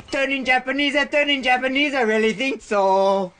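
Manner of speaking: high silly voice. Pretend. Sudden high put-on voice.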